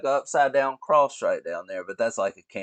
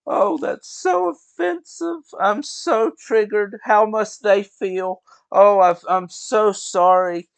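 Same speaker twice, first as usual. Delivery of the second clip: much higher, louder, slow whiny mock voice; mocking offended people; 12 semitones higher, 10 dB louder, slower than usual. Mocking offended comedy-show audience members, much higher whiny mock voice. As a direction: ham up a pearl-clutching offended audience member.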